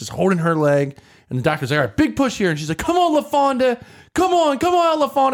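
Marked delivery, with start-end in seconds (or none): 1.8-2.5 higher coaxing doctor voice; doctor urging. Retold or imitated. 2.8-5.3 high excited coaxing; rally her through the push; cheering. Retold or imitated. Nurse cheering on delivery.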